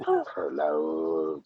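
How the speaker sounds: laugh, then protest through it. Real, not acted. Laughing at his own dating quote.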